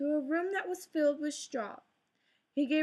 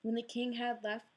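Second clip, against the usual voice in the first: ordinary delivery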